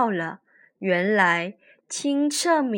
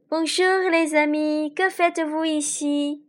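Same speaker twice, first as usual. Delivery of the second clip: lady of the lake; 6 semitones higher than usual. A narrator's reading. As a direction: give the lake lady a lifted voice. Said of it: "dit la voix du lac".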